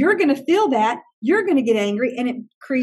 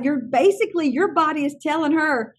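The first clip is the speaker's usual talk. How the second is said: usual speaking voice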